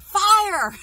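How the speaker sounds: very high, loud drawn-out yell; re-enacted shout. Finally warning friends of a kitchen fire, shouted. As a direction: blurt the word out as a big alarm cry.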